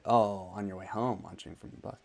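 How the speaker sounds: ordinary talk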